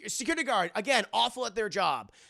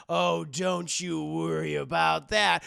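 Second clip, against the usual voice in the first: sinister villain; 7 dB louder, slower than usual. Acted. Villain taunting.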